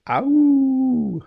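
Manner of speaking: high drawn-out wolf howl. Shown as a demonstration. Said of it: "That's my best impression of a wolf".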